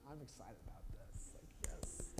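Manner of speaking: breathless excited whisper; thrilled hushed aside. Retold or imitated. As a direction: gush it under your breath. Crowd gushing over new king.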